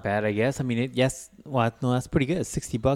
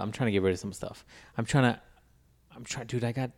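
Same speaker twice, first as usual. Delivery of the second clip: normal talking voice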